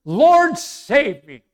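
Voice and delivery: dramatized cry, loud and high on Lord; acted desperate plea. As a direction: cry for rescue, then let it fall. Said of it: "He cried out".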